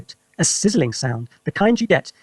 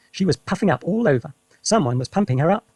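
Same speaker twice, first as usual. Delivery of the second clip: usual speaking voice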